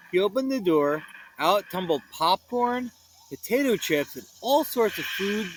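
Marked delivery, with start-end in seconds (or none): none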